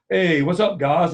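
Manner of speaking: put-on breezy Pidgin-speaking local kid; light Hawaiian Pidgin voice; Hawaiian Pidgin greeting. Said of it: "he's just super pigeon".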